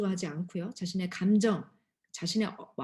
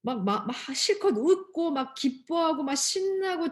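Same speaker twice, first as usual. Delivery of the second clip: excited; 6 semitones higher, 3 dB louder, slower than usual. Acted. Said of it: "여러분".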